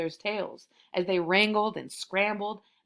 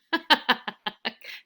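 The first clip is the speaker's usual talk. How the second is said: bursts out laughing at the story; real laugh; 3 semitones higher than usual. Real, not acted. Reader cracks up at the tiger wearing shoes, laughing. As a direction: genuine amused laughter. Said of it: "That's hilarious to me".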